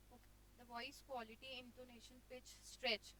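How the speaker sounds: very soft, listless reading; bored teacher droning under the breath; dull teaching demo. Shown as a demonstration. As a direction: read it out slowly and quietly, with no enthusiasm. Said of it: "पढ़ाते पढ़ाते मैं ऐसे बोलूँ".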